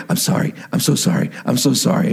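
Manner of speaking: low, hushed mock apology; half-voiced, repeated pleading for forgiveness; apologetic dad. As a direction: imitate a dad apologizing under his breath. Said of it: "is my dad going".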